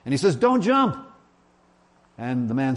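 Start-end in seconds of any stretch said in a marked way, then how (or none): 0.4-1.0 high, urgent call to stop; alarmed, raised-pitch plea; urgent cry. Acted. Urgent plea to man on bridge.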